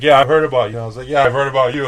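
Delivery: loud, high-pitched retold greeting; animated, punchy quote; excited recognition. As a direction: call it out like meeting someone known by reputation. Retold mutual greeting between cooks.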